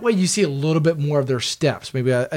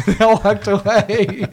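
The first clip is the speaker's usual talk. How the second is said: higher, drawn-out words through a laugh; 5 semitones higher, 3 dB louder, slower than usual. Real, not acted. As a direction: tell it while cracking up. Laughing at his own story.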